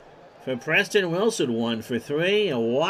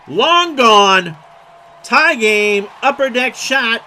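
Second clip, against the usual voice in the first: roaring home run call, much louder and higher; 7 semitones higher, 11 dB louder, slower than usual. Real, not acted. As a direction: belt it out as the ball leaves the park. Home run call, tying the game.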